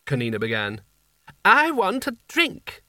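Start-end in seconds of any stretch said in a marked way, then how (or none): 1.4-2.8 much higher, slow, peevish snap; snapped. A narrator's reading. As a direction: bite it off like a spoilt sultan. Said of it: "snapped Creosote".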